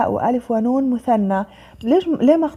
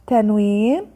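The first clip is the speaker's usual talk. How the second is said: usual speaking voice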